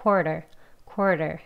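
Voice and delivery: "quarter" short, just a slight r in the first syllable. Shown as a demonstration. Teacher showing learners, "quarter" with slight er. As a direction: say "quarter" lightly with a hint of er. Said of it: "er 소리가 살짝 들어가기는".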